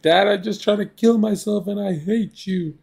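put-on high whiny teenage son blurting it out; imitating upset teen. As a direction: play the hurt teenager lashing out at dad. Teen friend confronts his dad.

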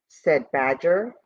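usual speaking voice

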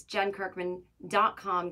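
ordinary talk, nothing marked

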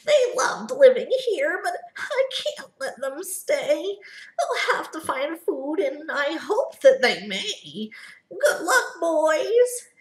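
high, pleading Lorax voice; a sad farewell to his Barbaloots; Lorax crying out. Acted. Lorax sending his Barbaloots away, high and sorrowful. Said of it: "he cried".

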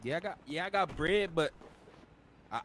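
ordinary talk

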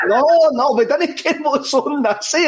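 high, slow voicing of the other lads; lightly voiced bewildered lads; mock confused reply. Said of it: "boys are going".

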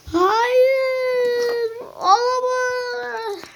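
high drawn-out wail of despair. Acted. To the monster holding him, a high drawn-out wail. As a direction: stretch it into a long whining cry.

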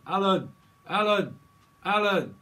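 loud high shout of a name; loud high urgent calling. Pretend. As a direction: yell for Alan urgently. Calling out Alan.

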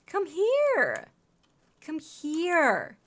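high, playful call dropping at the end; sing-song beckoning; calling a pet. Real, not acted. Calling a game dog over.

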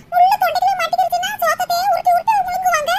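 ordinary delivery

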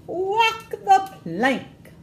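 high, very slow, loud pirate command; pirate mommy commanding. Acted. Pirate mommy ordering her kids at bath time, slow high command. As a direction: draw it out like a captain sentencing a prisoner. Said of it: "commands the pirate mommy".

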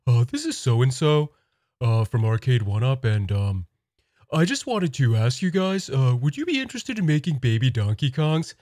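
lightly voiced dopey salesman; hesitant, slightly goofy introduction; Patrick. Arcade One Up rep greeting Nintendo, lightly Patrick-like voice. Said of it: "sounds like Patrick from SpongeBob".

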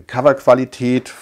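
normal talking voice

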